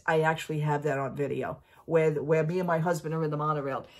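usual speaking voice

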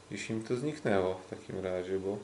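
ordinary talk, nothing marked

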